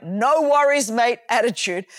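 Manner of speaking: ordinary talk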